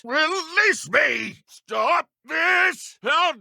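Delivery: outraged shouting. Acted. Trapped dark wizard rages at his captor, high furious shouts. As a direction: struggle and rage at your captor.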